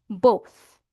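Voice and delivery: "both" as bow plus TH; final TH sound. Shown as a demonstration. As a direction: say "both" with the tongue-between-teeth TH ending. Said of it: "bow na may T H sound sa dulo".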